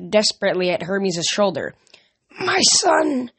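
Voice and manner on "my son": high, dry hissed voice; raised-pitch, raspy prophetic hiss. A narrator's reading. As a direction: hiss it like an eerie oracle. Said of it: "she hissed in a dry voice".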